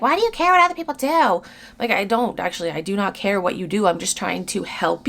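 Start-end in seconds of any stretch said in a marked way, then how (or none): 0.0-1.4 high, put-on whiny critic voice; high mock voice of a critic asking why she cares; mocking a commenter. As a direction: parody the nagging commenter, pitched way up.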